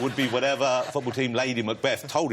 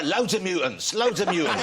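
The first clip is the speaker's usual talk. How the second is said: Loadsamoney voice; 6 semitones higher than usual. Retold or imitated. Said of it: "Harry Enfield's kind of wonga mad character".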